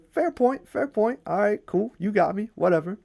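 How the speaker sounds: squeaky mock surrender, dropping toward the end; grudging concession. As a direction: concede in a high, put-on voice. Loki concedes defeat to the giant.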